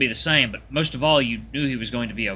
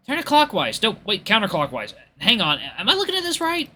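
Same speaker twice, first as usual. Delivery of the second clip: high-pitched, muddled self-correction; second-guess yourself, pitch shooting up; flustered confusion; 9 semitones higher than usual. Acted. Friend fumbling with tire instructions, flustered.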